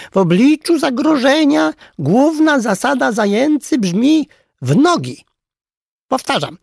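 high, loud teacher's announcement; shouting over others. A narrator's reading. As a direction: shout the rule over a noisy crowd. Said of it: "próbował właśnie przekrzyczeć zające". (przekrzykując hałas, wysoko i głośno)

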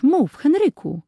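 high, slow urging; eager plea. Acted. Children begging their brother to tell what he saw, high and pleading. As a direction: beg him to go on, high and eager. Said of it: "prosiły dzieci".

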